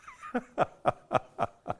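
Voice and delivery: high burst of laughter; a sudden high laugh at himself. Real, not acted. Speaker laughs at own word choice.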